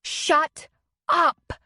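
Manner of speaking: high, loud shout. A narrator's reading. Leslie yelling at the brawling men from the porch, loud shout. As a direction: cut them off with a yell. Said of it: "Leslie shouted from the porch".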